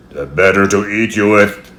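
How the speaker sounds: loud, menacing wolf growl. A narrator's reading. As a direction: drop the disguise and growl it. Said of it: "growled the wolf".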